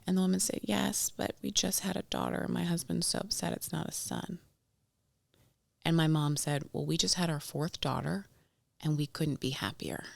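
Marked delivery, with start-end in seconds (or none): 0.6-4.4 soft retold reply from an upset mother; subdued confession. Crying new mother's quiet reply. 6.7-10.0 softly spoken, retold mother's reply; gentle contentment. Mom's gentle reply to stranger.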